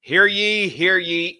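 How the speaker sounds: loud, high proclamation call; town crier. Pretend. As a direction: call out like a herald. Host opens show like crier.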